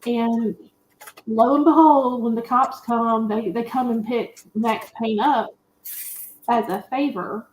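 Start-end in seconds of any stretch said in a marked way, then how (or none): none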